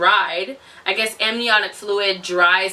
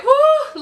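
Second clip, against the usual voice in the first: high, loud whoo to steel herself; bracing whoo; 5 dB louder than usual. Retold or imitated. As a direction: blow out a nervous whoo. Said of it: "So I was like".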